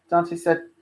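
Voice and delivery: ordinary delivery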